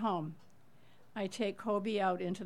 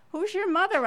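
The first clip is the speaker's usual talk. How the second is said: much higher, louder startled question; urgent question; 11 semitones higher, 8 dB louder than usual. Retold or imitated. Neighbor questioning the grieving daughter, high loud confusion.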